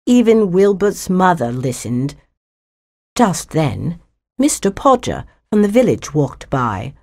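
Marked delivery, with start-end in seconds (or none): none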